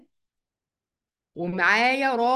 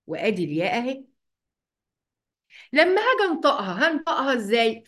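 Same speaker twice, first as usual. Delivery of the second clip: ordinary talk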